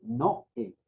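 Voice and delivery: Noé said slowly in two parts, no-É; broken diphthong. Shown as a demonstration. Teacher clarifying the word, slow split no-É for Noé. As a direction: slowly separate the o and stressed é in Noé. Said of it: "Se rompe el, ese, ese dictongo".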